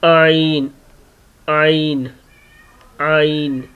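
आइन from the middle of the throat; Arabic ع from the throat; throat letter demo. Shown as a demonstration. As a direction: squeeze आइन out of mid-throat. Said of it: "हलक के दरमियानी ... लफ्ज़ अदा होता है आइन".

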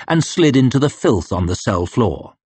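ordinary delivery

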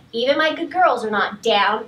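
usual speaking voice

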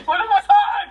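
high, loud laughing question. Real, not acted. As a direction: laugh through the words. Laughing at herself.

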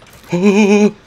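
high sudden intake of breath; loud frightened gasp; sharp startled gasp. Real, not acted. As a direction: gasp as if grabbed. Sudden scare in game.

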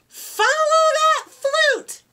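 high excited call of "Follow that flute!"; yelled. A narrator's reading. Fifi yelling after her runaway flute, high excited call. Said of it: "yelled Fifi".

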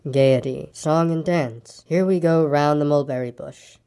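low, slow, resigned donkey; gloomy Eeyore. Acted. Eeyore brushes off Pooh's concern, low and resigned. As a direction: trudge through it low and mournful.

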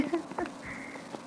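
soft airy chuckle at herself; quiet breathy laugh. Real, not acted. Streamer laughing at her poor gameplay, soft airy chuckle.

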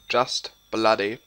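usual speaking voice

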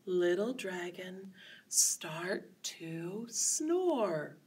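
soft, very slow sleepy voice. Shown as a demonstration. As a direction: lull them toward sleep. Sleepy dragon instruction.

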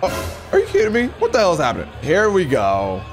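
ordinary delivery

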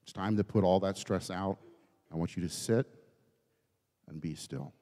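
soft, low, settling down; hushed stillness. Real, not acted. Preacher tells congregation to put stress out, hushed.

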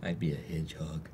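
tiny whispered hedgehog voice; shy snuffly hedgehog. Acted. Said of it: "Is that your hedgehog voice?".

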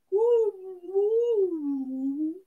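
drawn-out rising and falling woo; playful imitation of underwater speech; underwater hello. Streamer says hello to Sandy's gang in underwater speak. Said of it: "That's hello in underwater speak".

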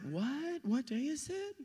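soft, high, puzzled questions; quiet high-pitched comic bewilderment; mock oblivious. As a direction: play someone just woken and clueless. Jesus waking unaware amid panic.

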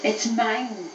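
ordinary delivery, nothing marked

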